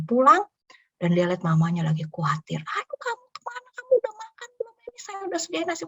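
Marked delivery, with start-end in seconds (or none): 2.7-4.7 very high, anxious mother's voice; mother anxiously greets her returning son, very high; worried mother. Retold or imitated. 4.9-5.9 very high, anxious mother's voice; mother anxiously greets her returning son, very high; worried mother. Retold or imitated.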